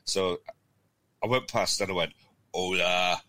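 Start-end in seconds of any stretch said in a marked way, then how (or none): 2.5-3.2 put-on a slightly louder Spanish-accent hola, called out to the locals in passing; light Spanish-accent hola to strangers at a pub; best Spanish voice. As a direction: greet them with your best Spanish accent. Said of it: "in my best sort of Spanish voice".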